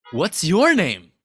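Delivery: acted, emphatic asking with "your" stretched; pointed question on YOUR. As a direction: lean hard on "your", pitch leaping up then falling. God pointedly asks Adam his name.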